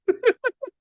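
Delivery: high burst of laughter. Real, not acted. Preacher laughs at his own hospital story, amused. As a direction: laugh out at the punchline.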